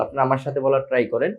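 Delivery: normal talking voice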